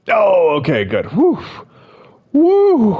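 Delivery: high, loud whoop of relief; real, rushing relief; relieved after a scare. Real, not acted. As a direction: let out the scare with a big, high whew. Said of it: "scared me for a second".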